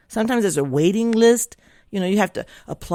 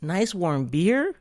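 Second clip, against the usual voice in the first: slow, questioning disbelief; drawn-out, doubtful rising question; incredulous echo; 3 dB quieter, much slower than usual. Retold or imitated. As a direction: repeat it slowly as a baffled question. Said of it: "I'm thinking".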